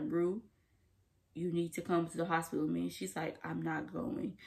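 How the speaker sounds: soft, low, almost under the breath; hushed retelling. Retold or imitated. Vlogger hushing while retelling Leslie and Rue's standoff, soft and low. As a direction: drop the voice for the tense moment. Quiet retelling of tense scene.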